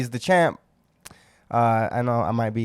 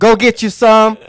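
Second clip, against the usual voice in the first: hype shout; 8 semitones higher, 9 dB louder than usual. Acted. Hyped shout-out to his dojo.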